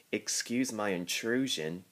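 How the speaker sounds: usual speaking voice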